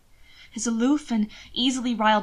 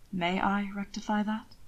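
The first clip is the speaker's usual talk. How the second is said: a touch of soft courtly voice; 7 dB quieter than usual. A narrator's reading. Hannibal asking for a kiss.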